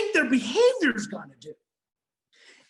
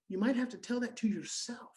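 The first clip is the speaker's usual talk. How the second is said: hushed, much softer aside; whisper; 4 semitones lower, 10 dB quieter than usual. Real, not acted. Speaker confides to parents in a whisper. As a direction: whisper it like a secret. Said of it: "whispered aside to parents".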